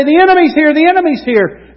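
high, loud warning cry; watchman's alarm call. Acted. Watchman warns the city from the tower, calling out loudly.

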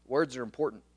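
ordinary talk, nothing marked

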